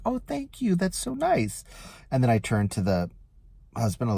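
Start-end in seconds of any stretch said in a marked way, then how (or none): 0.1-1.6 sweet thanks. Retold or imitated. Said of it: "she's like".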